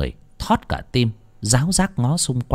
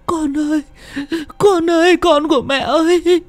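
plain reading voice

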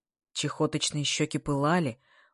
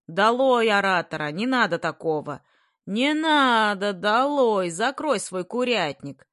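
crowd heckling; 4 semitones higher, 5 dB louder than usual. A narrator's reading. Classmates jeer at the orator, heckling shouts. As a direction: shout the speaker down.

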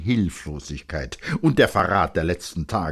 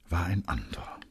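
usual speaking voice

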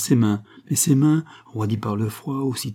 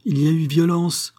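high, emphatic declaration; accusing exclamation; 7 semitones higher, 4 dB louder than usual. A narrator's reading. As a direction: confront her with the discovery. Doctor accuses, pointing at corpse.

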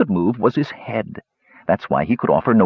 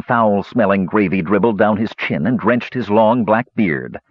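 usual speaking voice

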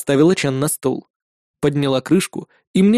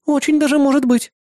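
put-on high bright girlish voice; young woman voice; 11 semitones higher than usual. A narrator's reading. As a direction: voice the demon girl cheerfully. Irda agreeing.

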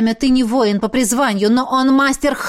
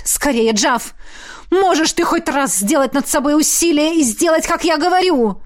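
high, exasperated urging; shouting; 5 semitones higher than usual. A narrator's reading. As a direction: shout at him in urgent exasperation. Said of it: "выкрикнул Эммет". (раздражённо кричать, торопя)

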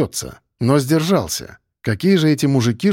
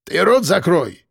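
slow, higher angry snarl; barked order; 4 semitones higher, slower than usual. A narrator's reading. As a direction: shut them up with a drawn-out bark. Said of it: "рявкнул он". (рявкая, растянуто)

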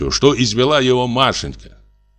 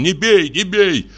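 high, slow, desperate cry; 10 semitones higher, slower than usual. A narrator's reading. Husband running in to stop the beating, desperate cry. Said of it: "кричит отчаянным голосом".

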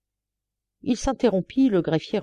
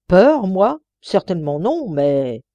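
indignant protest; 2 semitones higher, 7 dB louder, slower than usual. A narrator's reading. Clerk denies fear to the judge, loud indignant protest. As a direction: bristle at the suggestion.

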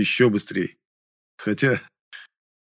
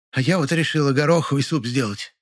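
high voice, full of importance; self-important housewife; 5 semitones higher than usual. A narrator's reading. Neighbour announces her pea soup, self-important. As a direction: put on a grand, high-pitched matron. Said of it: "важным голосом отвечала ей Полина Харитоновна".

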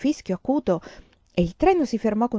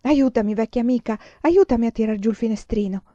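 higher, louder urgent request; eager mother; 4 semitones higher, 5 dB louder than usual. Acted. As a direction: hurry your old friend, eager. Mother eager to see hometown. (madre impaziente, voce più alta)

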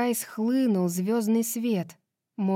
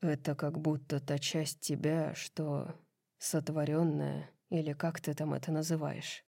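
low, hushed, halting murmur; soft, low lover's voice; breathed out; 4 semitones lower, 8 dB quieter than usual. A narrator's reading. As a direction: let it out softly on a breath, searching for words. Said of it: "выдохнул он".

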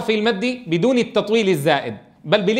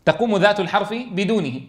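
ordinary talk

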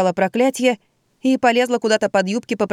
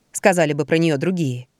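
usual speaking voice

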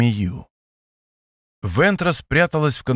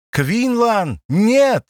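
desperate cry; 9 semitones higher, much slower than usual. Acted. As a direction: scream his name in despair. Ventress cries out to Vos.

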